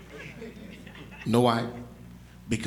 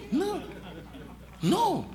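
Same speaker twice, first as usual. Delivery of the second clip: high mock no; 11 semitones higher, 6 dB quieter than usual. High squeaky refusal.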